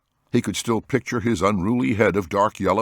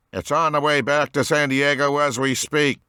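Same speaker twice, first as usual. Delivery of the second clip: monotone voice; 8 semitones higher than usual. A narrator's reading. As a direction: deliver it in a monotone. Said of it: "in a monotone voice".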